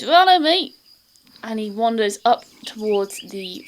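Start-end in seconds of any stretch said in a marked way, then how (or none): 0.0-0.7 higher and louder servant's call; gatekeeper voice. Acted. Gatekeeper leading the adventurers in, higher and louder. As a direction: beckon the party along.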